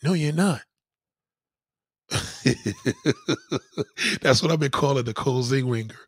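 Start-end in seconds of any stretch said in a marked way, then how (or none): 2.1-4.2 squealing high laugh; high-pitched giggle. Real, not acted. Laughing at own joke.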